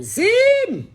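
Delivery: slow, high alien announcement; Zim voice. Acted. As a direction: proclaim it like a cartoon alien. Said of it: "say a Zim line".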